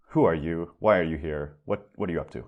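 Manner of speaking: normal talking voice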